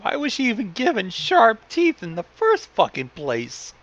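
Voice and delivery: ordinary delivery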